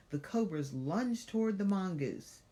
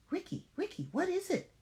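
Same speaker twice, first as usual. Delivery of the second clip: high, hesitant worried call. Acted. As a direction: call to him uneasily. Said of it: "with a little hesitancy in her voice".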